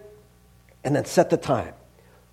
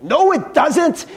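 "No, it doesn't" drawn out high and slow; emphatic rebuttal; 8 semitones higher, slower than usual. Real, not acted. Cook rebutting the twelve minutes claim, emphatic "No, it doesn't". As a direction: hit each word of "No, it doesn't" in protest.

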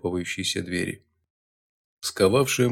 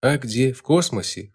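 ordinary delivery, nothing marked

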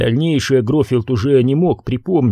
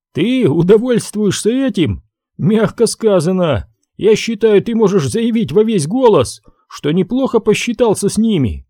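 higher-pitched, lively reply with amusement; amused Harley; 7 semitones higher than usual. A narrator's reading. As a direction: answer back animatedly, pitch lifted. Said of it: "Харли засмеялся". (оживлённо, весело, повышенным тоном)

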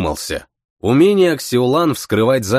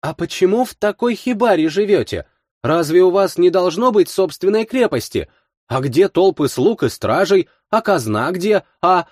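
put-on curious girl voice; 7 semitones higher than usual. A narrator's reading. Girl asks eagerly.

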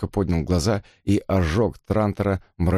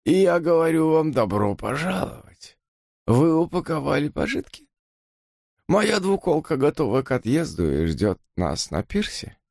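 thin reedy voice; 7 semitones higher than usual. Acted. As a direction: rattle it out unpleasantly. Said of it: "неприятным дребезжащим голосом".

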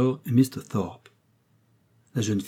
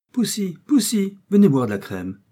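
calling the cat; 10 semitones higher, 4 dB louder than usual. A narrator's reading. Old gentleman calling his beloved cat with coaxing delight. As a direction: draw out the name to coax the cat over. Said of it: "en appelant le magnifique chat".

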